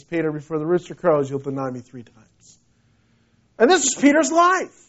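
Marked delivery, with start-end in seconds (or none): 0.0-2.6 lower, grave voice of the Lord; the Lord answering. Acted. The Lord foretelling Peter's denial, low and grave. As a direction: drop low and settle it, fading quieter at the end.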